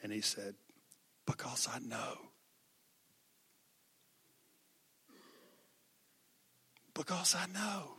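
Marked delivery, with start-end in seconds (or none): 1.2-7.9 softer, whispered through sobs; broken whisper. Retold or imitated. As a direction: whisper it, spent from crying. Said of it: "he started sobbing".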